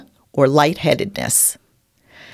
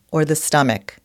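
ordinary talk, nothing marked

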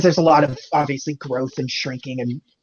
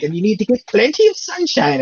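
Merlin voice; 6 semitones higher, 4 dB louder than usual. Retold or imitated. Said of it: "where it's like".

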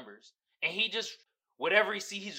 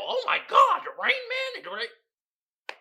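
mock rapturous shriek, much higher and louder; gushing critic; 5 dB louder than usual. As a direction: ham up a critic swooning over the film. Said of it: "them critics be loving that shit".

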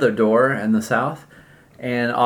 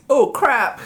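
startled bird; 5 dB louder than usual. Acted. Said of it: "the bird goes".

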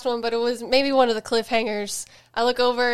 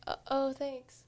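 sheepish mumble; 12 dB quieter, slower than usual. Retold or imitated. Wife mimics husband's sheepish thanks.

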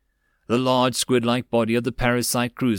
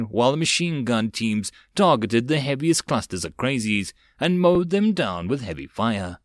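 plain reading voice

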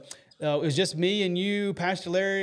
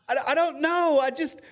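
much higher, choked-up retelling; overwhelmed; 11 semitones higher than usual. Retold or imitated. Telling Haley outside the house what happened, high tearful voice. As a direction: pitch jumps up as if weeping again. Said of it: "And I said".

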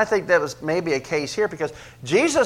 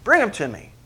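exasperated command, higher; frustrated; 6 semitones higher, 3 dB louder than usual. Shown as a demonstration. Jesus ordering the boy brought, frustrated. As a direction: snap it out in frustration. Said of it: "You hear the frustration there?".

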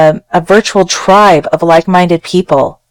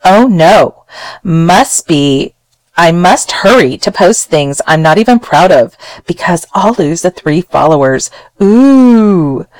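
sarcastic. Acted. Podcaster reading a letter writer's post aloud, sarcastic. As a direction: ham up the fake dread. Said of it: "I'm just hearing the sarcastic tone".

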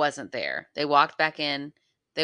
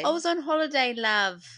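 put-on higher, sing-song British accent; hammed-up British accent; 9 semitones higher than usual. Co-host echoing the line, adding a British "love". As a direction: lay the British charm on thick.

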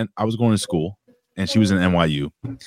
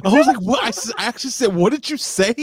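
incredulous. Retold or imitated. Host defending himself to teasers, incredulous. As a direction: squeal the shocked reply. Retelling shocked reaction, laughing.

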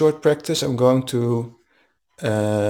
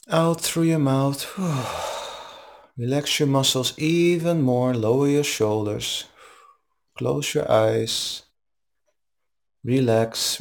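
calm, even meditation guide voice; guided meditation. Acted. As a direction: guide them gently into the breath. Leads a guided breathing meditation.

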